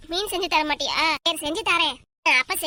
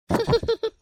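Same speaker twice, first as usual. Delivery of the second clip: higher-pitched burst of giggles; laughing; 5 semitones higher than usual. Acted. Host laughs at friends backing down, giggling. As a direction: laugh as the bluff falls apart.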